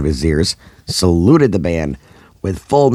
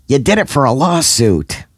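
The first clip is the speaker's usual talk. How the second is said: yelled back; 7 semitones higher than usual. A narrator's reading. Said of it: "Sue yelled back".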